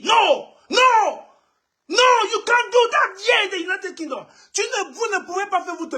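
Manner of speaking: angry shouting. Real, not acted. Ranting at the offending couple, furious shout. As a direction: shout them down, outraged.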